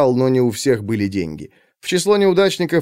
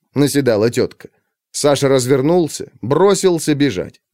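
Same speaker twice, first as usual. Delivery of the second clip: usual speaking voice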